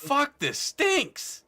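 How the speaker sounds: high, drawn-out mock wail; disgusted guard. Game master voicing a guard dragging a stinking corpse, disgusted wail. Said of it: "He's not crying, but he goes".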